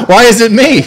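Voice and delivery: high laughing protest. Real, not acted.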